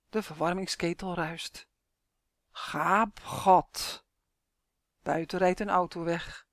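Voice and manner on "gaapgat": ominous intoning from the grave; sepulchral voice. A narrator's reading. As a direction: intone the word hollowly, like a tomb. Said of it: "met een grafstem". (met een grafstem)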